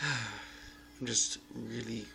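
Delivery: slow, drained and sighing; barely any energy left, dragging; exhausted. Real, not acted. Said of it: "really fucking exhausted".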